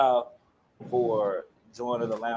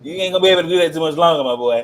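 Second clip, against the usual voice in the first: teasing warning; 6 semitones higher, 12 dB louder, much faster than usual. Real, not acted.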